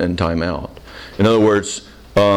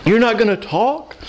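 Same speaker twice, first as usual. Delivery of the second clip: mock dismay; 11 semitones higher than usual. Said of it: "And I wanna go".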